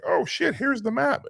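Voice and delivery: imitated child. Child handing over the map, high-pitched imitation. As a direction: pitch it up like someone little looking up. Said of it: "a little person or a child looking up".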